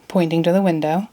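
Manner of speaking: ordinary delivery, nothing marked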